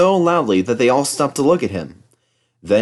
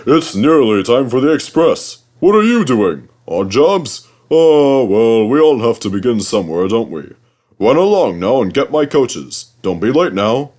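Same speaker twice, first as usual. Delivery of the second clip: proud Gordon; 5 dB louder than usual. A narrator's reading. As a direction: puff yourself up, boastful big engine. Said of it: "he said next morning".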